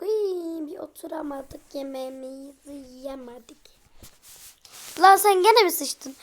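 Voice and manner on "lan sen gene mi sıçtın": loud, exasperated scolding; exasperated shout. Real, not acted. Scolding the baby loudly.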